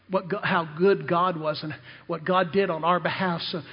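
normal talking voice

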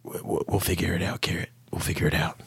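hushed, breathy whisper of comfort; reassuring whisper. Acted. Battered friend whispers comfort to Garrett, a soft whisper. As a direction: whisper it gently, like a promise.

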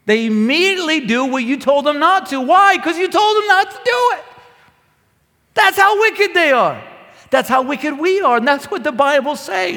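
high, loud fervent preaching; preaching at full force. Acted. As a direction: drive it home to the church.

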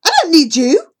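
loud, high, furious cry; angry shout. Acted. Girl storming out, shouting at her best friend in fury. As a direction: yell it at him in hurt rage. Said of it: "storm outside".

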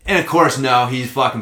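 ordinary delivery

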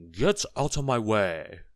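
loud, slow angry order; drawn-out bad-tempered shout; rude snap. A narrator's reading. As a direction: order them aside rudely at full voice. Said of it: "snapped Mister Uppity rudely".

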